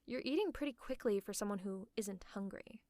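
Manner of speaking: ordinary delivery, nothing marked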